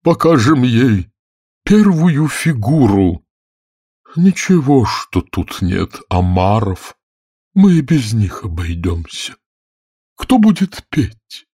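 high, slow, drawn-out creature voice; mournful turtle taking charge; plaintive storybook turtle. A narrator's reading. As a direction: intone it with ceremony. Narrator voices the Mock Turtle.

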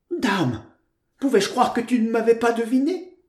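high, animated, effusive; high-pitched affectionate scolding; joyful exclamation. A narrator's reading. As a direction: exclaim to your friend in relieved delight. Joyful reconciliation of the two friends.

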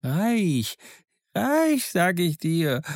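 worked-up repetition of „reich“; excited. A narrator's reading. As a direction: insist eagerly on „reich“. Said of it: "wiederholte ganz aufgeregt".

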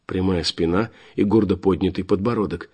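usual speaking voice

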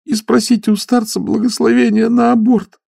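put-on girl begging the stoker through tears; tearful pleading. A narrator's reading. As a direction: plead like a desperate young girl. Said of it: "сквозь слёзы стала умолять девица".

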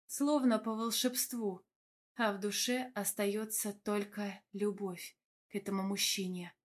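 plain reading voice

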